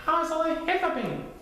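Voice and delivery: high-pitched questioning cub; high, innocent little bear; Little Bear character voice. A narrator's reading.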